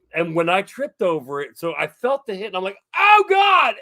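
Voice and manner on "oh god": high, loud re-enacted fright; startled cry. Retelling feeling the hit in the hallway, high startled cry. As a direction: act out a sudden jolt of panic.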